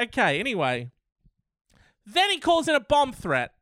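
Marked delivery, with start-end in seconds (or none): 2.0-3.5 high incredulous punchline. Real, not acted. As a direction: deliver it way up in disbelief.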